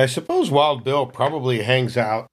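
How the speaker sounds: normal talking voice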